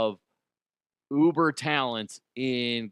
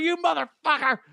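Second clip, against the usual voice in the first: mock furious shout, much higher and louder; furious curse; 6 dB louder than usual. Mosier cursing Chad after the hat hits his nose, mock furious yell. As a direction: hurl it like you just got hit in the nose. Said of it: "Mosher was so pissed off. He's like".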